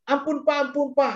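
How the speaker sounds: high-pitched imitation of begging; pleading. Voicing a punished person begging.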